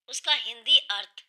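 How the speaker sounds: ordinary talk, nothing marked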